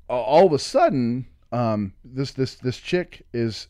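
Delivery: ordinary talk, nothing marked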